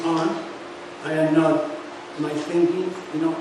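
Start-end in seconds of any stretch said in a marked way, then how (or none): none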